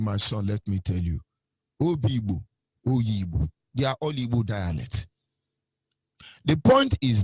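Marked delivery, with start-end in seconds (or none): 0.0-5.1 put-on a hushed old man's counsel; lightly voiced elder, soft and low. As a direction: quietly voice the elder father. Elder quoted softly.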